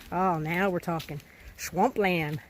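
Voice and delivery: ordinary talk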